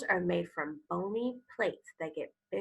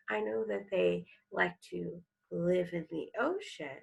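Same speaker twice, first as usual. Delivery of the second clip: ordinary talk, nothing marked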